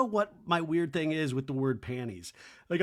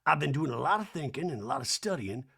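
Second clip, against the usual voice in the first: soft mock Southern musing; hammed-up soft socialite pondering; Sutton; 5 dB quieter than usual. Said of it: "I love that Sutton says this".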